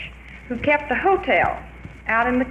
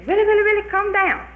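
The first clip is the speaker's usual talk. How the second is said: high, loud sing-song coaxing call; chanted witch's lure, high and loud; witch calling up the tree; 10 semitones higher, 5 dB louder than usual. Retold or imitated. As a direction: croon him down like a wheedling witch.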